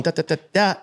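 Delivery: even engine noise, da da da; steady engine imitation. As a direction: imitate a smooth engine rev. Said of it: "instead of just going".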